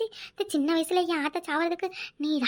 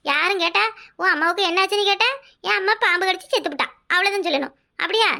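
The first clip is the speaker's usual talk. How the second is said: ordinary delivery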